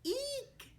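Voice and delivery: playful mouse squeak. Pretend. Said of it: "don't forget to squeak".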